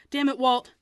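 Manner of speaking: sharp, loud angry hiss at Walt. A narrator's reading. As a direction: snap at him in a loud hiss. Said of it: "I hissed".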